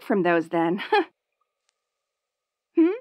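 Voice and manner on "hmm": normal talking voice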